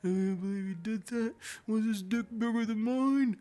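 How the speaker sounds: mock your own heartbroken wail, building to a peak; delusional wailing. Cheated-on boyfriend questioning her, hammed-up delusional wail. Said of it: "my dumbass was so delusional".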